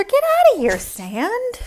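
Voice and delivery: high, loud shoo at the sand; playful scolding of the sand; mock shooing. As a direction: chase the sand off.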